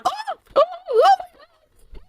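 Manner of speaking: high, hammed-up scared little cries; mock fearful whimpering. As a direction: ham up a helpless lady's panic. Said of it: "making a big production out of it".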